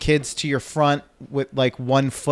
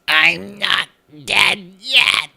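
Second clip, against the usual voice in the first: slow, higher, creaky dying creature voice; Skeksis impression; 6 semitones higher, much slower than usual. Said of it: "I think it was more like the Skeksis".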